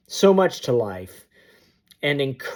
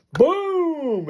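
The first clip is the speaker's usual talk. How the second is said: a high, sharp mock boom; 12 semitones higher than usual. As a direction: slam the word out like the wheels hitting. Imitating the hard landing.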